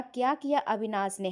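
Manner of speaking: usual speaking voice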